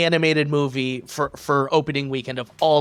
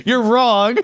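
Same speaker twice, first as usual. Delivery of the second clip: loud, high mock correction; raised-voice playful outrage; mock indignation; 8 semitones higher, 7 dB louder than usual. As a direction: jokingly shout down a mistaken kid. Mock outrage at child's mistake.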